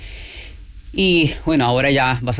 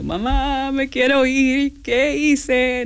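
high, slow, mock tearful wail to mom; imitating crying plea; 10 semitones higher, much slower than usual. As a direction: whine it like a homesick recruit in Basic.

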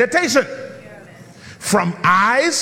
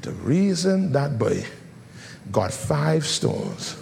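hushed confiding storytelling; 8 semitones lower, 5 dB quieter than usual. Acted. Sudden hush voicing David's private thoughts.

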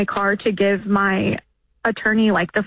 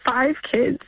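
higher, tearful voice; emotional; 4 semitones higher than usual. Real, not acted. Caller telling the host about her children, emotional. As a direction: get the words out through real tears. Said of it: "I didn't want to get emotional".

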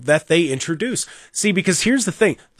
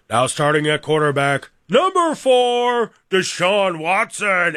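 stadium announcer; 2 semitones higher, slower than usual. Retold or imitated. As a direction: stretch each word like a hyped PA announcer. Mimics PA introducing the quarterback.

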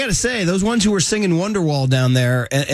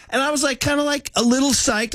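excited retelling; 10 semitones higher than usual. Real, not acted.

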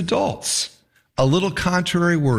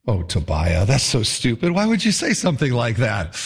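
groaning; 6 semitones lower than usual. Retold or imitated. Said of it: "I hear everyone going".

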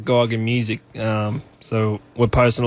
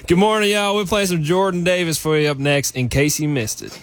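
high, put-on American radio voice; Australian doing an American DJ accent; cheery American accent song intro, starting high; 6 semitones higher than usual. Shown as a demonstration. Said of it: "give me that American accent one more time".

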